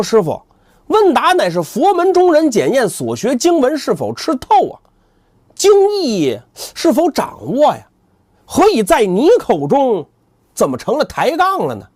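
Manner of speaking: high, measured preaching reply; earnest pious monk. Acted. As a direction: defend the scripture with dignity. 三藏不乐意辩驳. (高声庄重的僧人辩驳)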